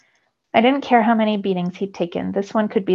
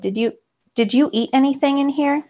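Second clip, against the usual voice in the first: frantic, higher-pitched question; 6 semitones higher than usual. A narrator's reading. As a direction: ask in a sudden panic. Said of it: "looking around frantically".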